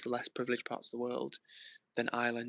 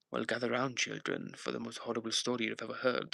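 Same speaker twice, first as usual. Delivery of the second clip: put-on spooky horror narrator voice; spooky storyteller. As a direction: gather listeners in for a scary tale, mock-ominous. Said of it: "hopefully it sounded pretty spooky".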